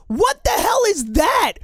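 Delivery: high-pitched, incredulous venting; exasperated rant. Real, not acted. Host fuming at the front office's collaborative quote, exasperated.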